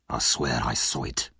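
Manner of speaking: a hint of shaken fear, low. A narrator's reading. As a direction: swear it, still shaken. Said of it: "replied Monks".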